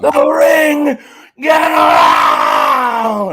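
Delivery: ring announcer. Acted. Hyping the wrestler to his cohost, slow loud announcer call.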